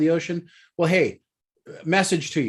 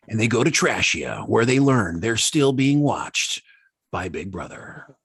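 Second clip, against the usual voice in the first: movie trailer voice; 5 semitones lower than usual. Pretend. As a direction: intone it like an epic film trailer. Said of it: "you need to do it in your movie voice".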